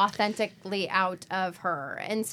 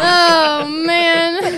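mock grief; 7 semitones higher, 11 dB louder than usual. Mock grief at sponsor loss.

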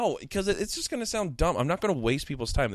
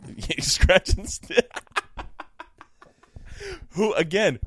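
giggling through the words; laughing; 8 semitones higher, slower than usual. Real, not acted. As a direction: laugh while saying it slowly. Laughing while correcting tongue slip.

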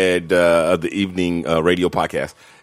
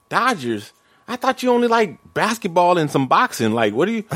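Dodgers? dropped with a falling, doubting tune; a higher voice as the wife who doesn't buy it; wife calling out his new jersey, skeptical; 9 semitones higher than usual. Retold or imitated. Wife doubting him, retold.

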